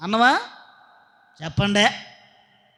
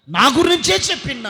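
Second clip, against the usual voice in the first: mock offended; 6 semitones higher, 7 dB louder than usual. Mimics offended listener.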